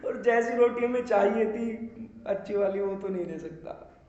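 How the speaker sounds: laughing bitterly through the words; a hollow laugh at his own failure. Real, not acted. Bitter laugh about failed theatre. (कड़वी हँसी के साथ बोलना)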